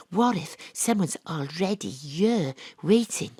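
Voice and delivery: normal talking voice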